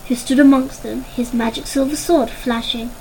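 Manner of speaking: ordinary delivery, nothing marked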